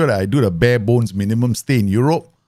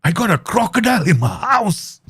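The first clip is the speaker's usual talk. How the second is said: voicing his friend; 10 semitones higher than usual. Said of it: "Hey, dude".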